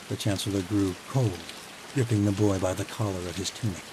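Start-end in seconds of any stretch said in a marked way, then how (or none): none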